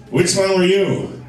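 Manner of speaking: a lightly voiced drunk mom asking; drunk mother. Drunk mother asks comic at the show, tipsy. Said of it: "she was drunk as hell".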